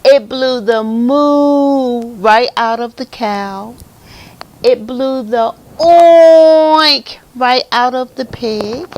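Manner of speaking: slow, loud, with moo and oink held long; booming storytelling. A narrator's reading. Reader telling children about cow and pig, booming storytelling. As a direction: stretch the animal noises out big and loud. Animal noises in read-aloud.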